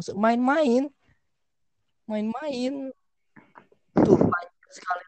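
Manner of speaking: mock teacher. Teacher quoted mocking.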